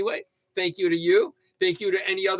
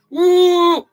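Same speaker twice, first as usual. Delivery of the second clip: whoop; 14 semitones higher, 11 dB louder than usual. Acted. Old man yelling at clouds.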